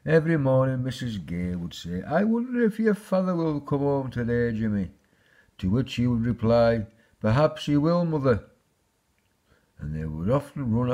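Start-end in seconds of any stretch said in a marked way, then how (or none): 2.0-4.9 high-pitched storybook mother; wondering mother. A narrator's reading. Mother's daily wondering, voiced in story. 7.2-8.4 put-on high, loud boy's reply; hopeful young son. A narrator's reading. Son's hopeful reply, voiced in story.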